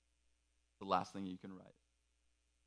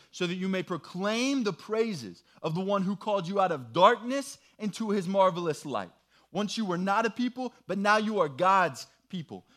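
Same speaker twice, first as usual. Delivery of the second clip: raised, louder proclaiming read; scripture reading; 6 semitones higher, 6 dB louder than usual. Acted. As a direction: proclaim the verse to the room. Reads the Bible passage aloud.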